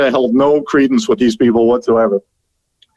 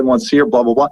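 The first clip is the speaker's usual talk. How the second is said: ordinary talk, nothing marked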